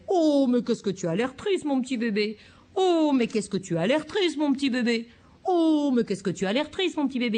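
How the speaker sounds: parent mielleux plus aigu et plus fort; parent caricaturé. Shown as a demonstration. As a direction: en faire des tonnes avec le bébé. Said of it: "Excusez-moi de caricaturer". (parent caricatural qui en rajoute)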